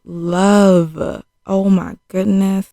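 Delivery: loud, drawn-out scolding disbelief; exasperated shock. Retold or imitated. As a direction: stretch it out, loudly appalled at her. Reacting to a shocking killing.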